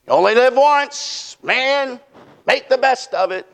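high, carefree party-guy slogan; mock live-it-up hype. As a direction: mimic a hyped-up YOLO believer. Mimics live-it-up slogan crowd.

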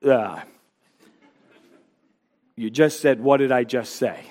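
put-on a kid caught not listening, stalling; clueless kid. Preacher voicing a child caught not listening, fumbling low.

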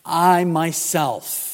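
"I myself" slow and loud, each word weighted; emphatic. Retold or imitated. Preacher repeating Jesus' words to the church, "I myself" slowly and emphatically. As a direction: stretch out and punch "I myself". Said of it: "quite emphatic".

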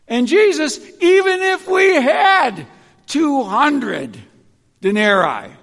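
loud, high exasperated protest; Philip protesting. Acted. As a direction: argue the numbers back at Jesus. Philip's objection voiced.

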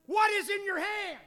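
high, loud, commanding God; a high, loud divine question; God's voice. Acted. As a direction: call down as God to Moses. God speaks to Moses.

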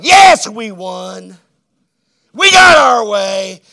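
slow, loud mock gloating shout; gloating mothers. Preacher mocking mothers who won the vote, slow loud gloat. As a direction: jeer it like a crowd that won.

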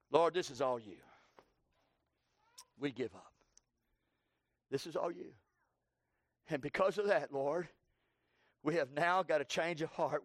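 hushed prayer. Acted. Preacher voicing the sailors surrendering to the Lord, hushed.

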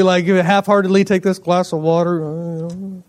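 ordinary delivery

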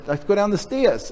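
put-on high little kid's voice; a toddler chirping it up high. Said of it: "and he said".

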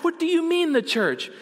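high, startled retort; imitated taken aback. Woman taken aback by his answer, high startled imitation. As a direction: act out her disbelief, starting high. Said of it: "she's taken aback".

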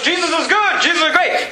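high-pitched, quick cheer for Jesus; mock enthusiasm. Preacher acting out shallow Christians, mock enthusiasm. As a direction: imitate an eager thumbs-up believer.

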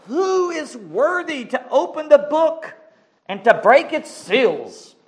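high proclaiming angel voice. Acted. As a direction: call it out across heaven. Angel's loud question voiced.

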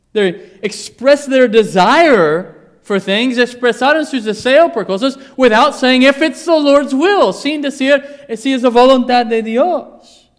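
loud, high, emphatic preaching; preaching at full force. Acted. Preacher pressing the church, loud and rising. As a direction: drive it home to the congregation.

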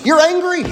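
You're angry, YOU'RE jabbed high; pointed reply. Shown as a demonstration. Preacher correcting the excuse, You're angry punched high. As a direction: snap back You're angry as a verdict. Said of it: "let's just use biblical language".

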